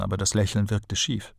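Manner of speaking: usual speaking voice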